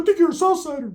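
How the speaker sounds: very high, squeaky mock voice; high cartoon voice imitation; Ricky's voice.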